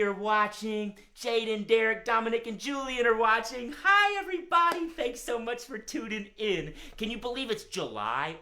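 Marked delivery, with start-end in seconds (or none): 3.8-4.9 high, loud call to the crowd; excited greeting. Real, not acted. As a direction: shout a big happy hello. Greeting all the watching friends.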